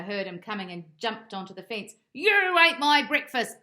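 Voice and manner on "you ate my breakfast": very loud, high accusing shout; furious dog shouting up. Acted. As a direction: yell it at the cat on the fence. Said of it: "Bob shouted".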